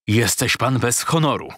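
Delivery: ordinary delivery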